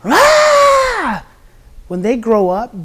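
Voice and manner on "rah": loud, high mock-angry roar. Shown as a demonstration. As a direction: let out a big angry shout. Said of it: "when they're angry".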